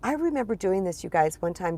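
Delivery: ordinary delivery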